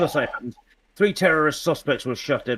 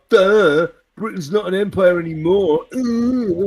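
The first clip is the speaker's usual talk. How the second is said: high, loud mock-dim objector; mocking imitation; 7 semitones higher, 6 dB louder than usual. Imitating people who deny Britain is imperial, mocking. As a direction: whine it like an indignant dimwit.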